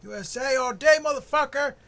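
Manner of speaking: high loud chant; hype yell. Real, not acted. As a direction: yell it like a rowdy fan.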